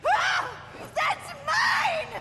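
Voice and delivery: high shout of outrage; outraged shriek. Acted. Villain shrieks as her prize is taken. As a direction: shriek in fury.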